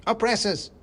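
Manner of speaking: very high squeaky battle cry; a tiny soldier lashing out; shouting mouse. Acted. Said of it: "he shouted".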